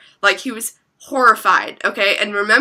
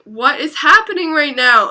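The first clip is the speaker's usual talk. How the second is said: higher, mildly frantic questioning; retold bewilderment; 6 semitones higher, 4 dB louder than usual. Storyteller who thought she was crazy, bewildered. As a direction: replay your bewildered thought in a raised pitch. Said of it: "I thought I was crazy".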